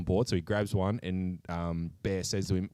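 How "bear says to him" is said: usual speaking voice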